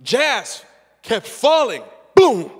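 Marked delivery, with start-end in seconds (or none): 2.1-2.5 big mock crash sound; loud boom. The speaker mimics his son falling on the ice, loud boom. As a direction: slam it like a fall.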